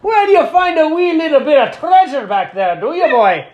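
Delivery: high, full-volume leprechaun lilt. Retold or imitated. At the stop sign with windows down, loud leprechaun voice. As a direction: holler like a cartoon leprechaun. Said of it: "keep going to the leprechaun voice".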